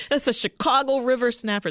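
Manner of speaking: hammed-up local Chicago accent. Host imitating a viral kayaker in a Chicago accent. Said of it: "That's my, my Chicago accent".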